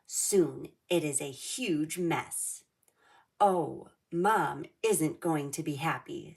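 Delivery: lively read-aloud storybook voice; reading to children. A narrator's reading. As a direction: read it like storytime for little kids. Reads picture book to kids.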